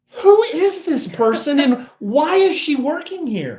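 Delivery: high, loud, incredulous retold thought; exasperated inner thought. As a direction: voice your disbelief as an outburst. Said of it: "And I'm thinking".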